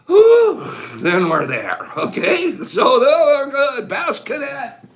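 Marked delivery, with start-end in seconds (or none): 0.1-0.6 loud high gasp of relief after a long rattle; breathless whew. Real, not acted. Host out of breath after a long candy list, loud whew. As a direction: blow out a big winded whew. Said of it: "get out of breath".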